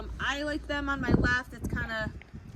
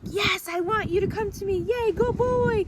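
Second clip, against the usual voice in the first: super excited; 8 semitones higher, 7 dB louder than usual. Shown as a demonstration. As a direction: show the dog you're delighted. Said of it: "I wanna get super excited".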